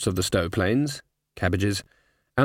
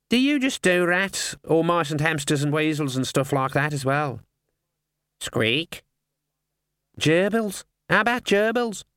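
high chatty bird voice; raspy cawing crow; 4 semitones higher than usual. Acted. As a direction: chat like a curious raven. Raven chatting with rat.

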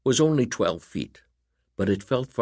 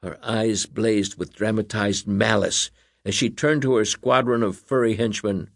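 ordinary delivery

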